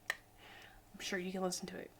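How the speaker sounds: whispered conspiratorial aside; hushed, joking aside to adult viewers. Real, not acted. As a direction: lean in and whisper it.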